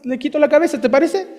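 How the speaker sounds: calmly, as if it's nothing; casual offer. Retold or imitated. Nephew offers his uncle the king a beheading, nonchalant. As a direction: lightly offer to take his head off. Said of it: "Tranquilo, así, nada más".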